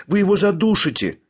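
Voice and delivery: high-pitched alarmed plea; pleading. A narrator's reading. Women fear he will choke him, pleading. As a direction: implore him to stop, voice high. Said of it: "взмолились одновременно обе женщины".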